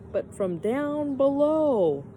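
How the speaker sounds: usual speaking voice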